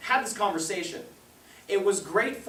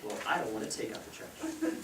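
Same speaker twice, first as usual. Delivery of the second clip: put-on low, soft grumble of a reluctant child; muted childish reluctance, acted out; reluctant kid; 7 semitones lower, 10 dB quieter than usual. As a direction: quietly balk at a chore, voice held down. Acting himself as reluctant child.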